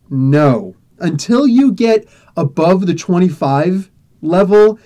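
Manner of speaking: normal talking voice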